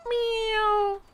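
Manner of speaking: very high squeaky meow; talking to a cat with a high mock meow. Meowing at the cat.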